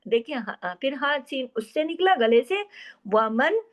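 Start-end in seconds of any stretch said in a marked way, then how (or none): none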